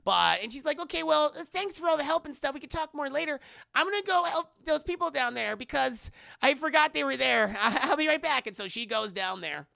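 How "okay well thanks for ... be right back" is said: put-on high, chatty girl's voice; high-pitched polite excuse; imitated girl. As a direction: rattle it off in a raised imitation voice. Voicing the girl.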